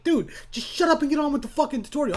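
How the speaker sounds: high, fast mock scolding; comic high-pitched nagging; impatient heckler. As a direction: heckle yourself in a high, exasperated voice.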